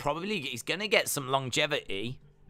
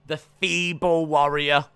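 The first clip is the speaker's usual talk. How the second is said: slower, louder ring announcer call; announcer read; 5 dB louder than usual. Acted. Introducing the Feeble Warrior, announcer voice. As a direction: announce him to the arena. Repeats nickname announcer-style.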